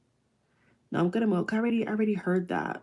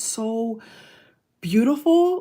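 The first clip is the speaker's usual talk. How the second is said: gushing; 3 semitones higher, 5 dB louder, slower than usual. Real, not acted. Gushing over his voice.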